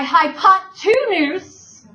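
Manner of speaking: "hypotenuse" slowly, TWO loud and stretched; two stressed inside word. Shown as a demonstration. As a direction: say "hypotenuse" as high-pot-TWOOO-noose. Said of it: "I'm gonna say it that way".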